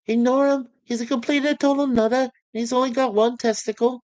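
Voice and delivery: mock him to the crowd; cheeky Lister heckle.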